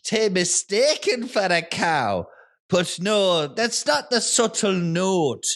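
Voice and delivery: put-on a suspicious farmer in an Irish-sounding accent, breaking into laughter. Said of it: "I've got the accent".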